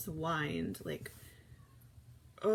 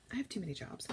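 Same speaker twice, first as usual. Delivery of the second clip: soft unvoiced whisper to herself; whispered aside; 8 dB quieter, faster than usual. Real, not acted. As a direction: whisper it off to the side.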